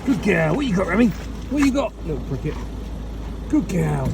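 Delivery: high sing-song dog praise; praising the dog. Real, not acted.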